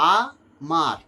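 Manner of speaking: আ, then মার, with a short break between them; each syllable said on its own; split into syllables. Shown as a demonstration. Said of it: "দুটো দল".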